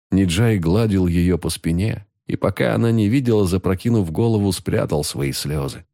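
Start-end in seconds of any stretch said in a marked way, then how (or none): none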